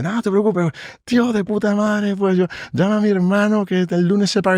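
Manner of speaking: very high, elated babble; overjoyed prisoner. Retold or imitated. Released client thanking his lawyer, very high and elated. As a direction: bubble over with joy.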